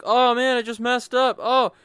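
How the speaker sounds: loud high groan of a blunder; dismayed exclaim. Retold or imitated. Gamer reacting to his mistake, loud dismay. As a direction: yelp it like you just botched a move. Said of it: "I'm like".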